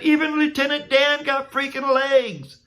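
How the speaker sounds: high, loud mock-sobbing whine; hammed-up crying complaint; blubbering. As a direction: wail it like a sore crybaby. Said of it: "I said".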